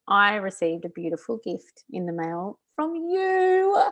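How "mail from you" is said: fighting back happy tears; tearful laugh. Real, not acted. Thanking her friend for a birthday gift, tearful laugh. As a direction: voice wobbling between laughing and crying. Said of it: "feel like I'm gonna cry again".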